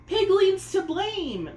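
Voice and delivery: high, loud, slow wail; bawling. A narrator's reading. Peony bawling after the sparrows attack, high slow wail. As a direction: wail it like a spoiled child bawling. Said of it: "Peony bawled".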